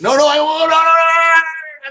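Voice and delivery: high, loud protesting no's; emphatic refusal. Acted. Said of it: "I'm, like, shaking my head".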